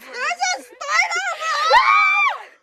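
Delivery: high shriek of alarm; screaming at a spider. Real, not acted. Spots a spider web.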